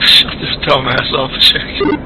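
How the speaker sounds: loud, irritated outburst; annoyed shout. Real, not acted. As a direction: snap loudly at the noisy guy. Annoyed at chatter on comms.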